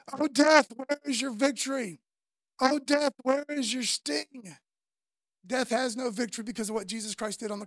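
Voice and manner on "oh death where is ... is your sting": preaching. Acted. Preaching scripture at full force.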